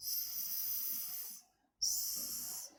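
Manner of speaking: long, soft snake hiss; drawn-out sibilant hiss. Acted. Snake hissing sound in the story.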